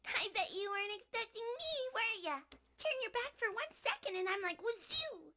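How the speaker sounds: put-on high, playful kid voice; cheeky child villain bragging; childlike voice. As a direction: tease like a bouncy child. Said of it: "she's literally like the essence of a child".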